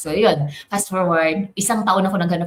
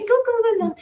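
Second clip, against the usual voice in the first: go go go; faster than usual. Retold or imitated. Self-encouragement.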